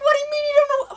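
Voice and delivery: high incredulous question; baffled. Retold or imitated. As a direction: disbelief at his stillness. Baffled by the intruder.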